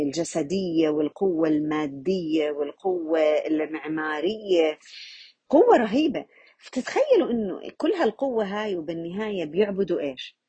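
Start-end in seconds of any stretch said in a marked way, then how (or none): 5.5-6.3 قوة رهيبة punched high and loud; emphatic. Real, not acted. Teacher hammers قوة رهيبة to awe the class. Stressing the terrible power.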